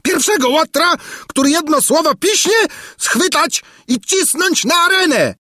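screeching-high royal threat; enraged shout. A narrator's reading. King Padella threatening anyone who speaks up, enraged shouting. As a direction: shout the threat at the top of the register. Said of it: "drżący z wściekłości".

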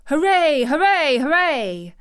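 loud, high hoorays; jubilant shout. A narrator's reading. What a healthy boy would shout at the news, jubilant. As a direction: shout it triumphantly. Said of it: "would probably have shouted".

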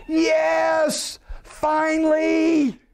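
high, drawn-out shout of joy; joyful loud voice of God. Acted. Preacher voicing God shouting for joy. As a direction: cry out in triumph, high and long. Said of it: "a loud voice. Almost like".

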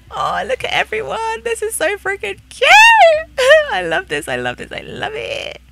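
very high, delighted squealing; gushing squeal. Real, not acted. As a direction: squeal with delight over something cute. Gushing over the cute scene.

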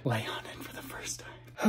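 soft whisper. Real, not acted. Excited about the new bed, whispering quietly. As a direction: murmur it under your breath.

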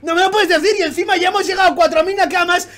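real hyped complaint; overwhelmed rant. Real, not acted. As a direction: vent like you're about to burst.

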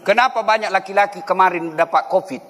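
ordinary talk, nothing marked

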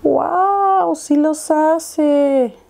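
high, drawn-out amazed wow; asombro encantado. Real, not acted. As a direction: marvel slowly, stretching the words high. Truco de los pelitos le funciona. (asombro agudo y alargado)

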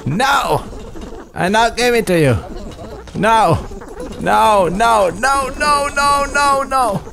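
panicked no. Real, not acted. Refusing the drooling creature, panicked high cries. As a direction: yelp no over and over in a panic.

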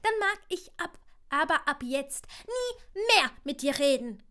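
put-on high, loud stubborn kid's outburst; defiant child. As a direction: stamp it out like a sulking boy. Child stamps foot, refuses.

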